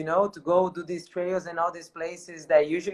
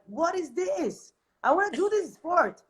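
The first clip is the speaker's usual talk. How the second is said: excited; 11 semitones higher than usual. Retold or imitated. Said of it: "So I was like".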